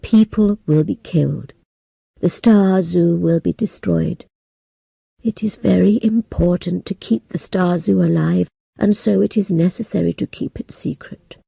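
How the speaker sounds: a hint of a sorry old man. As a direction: speak as a solemn elder breaking hard news. Old man explains Star Zoo secret.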